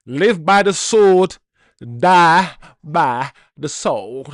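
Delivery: put-on slow, loud, drawn-out Southern accent line; Daniel Craig Southern accent. Fan quoting Knives Out, slow loud Southern accent. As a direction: boom it slowly like a Southern detective. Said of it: "I talk in a Southern accent".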